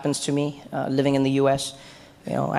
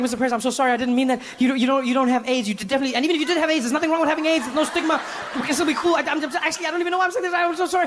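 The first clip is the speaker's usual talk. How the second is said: higher, flustered, babbling sorry; frazzled rambling apology; 9 semitones higher, faster than usual. Retold or imitated. To the president, a frazzled high-pitched apology. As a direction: panic and try to fix it. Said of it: "and I'm like".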